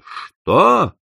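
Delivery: high, sharp burst of amazement; a stunned, high-pitched what; astonished cry. A narrator's reading. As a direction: blurt it out in shock. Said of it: "с изумлением выкрикнули".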